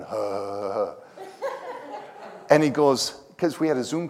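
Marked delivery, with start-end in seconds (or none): 0.0-0.9 low mock chuckle, softer; retold low ha-ha; imitated laugh. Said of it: "And he starts laughing".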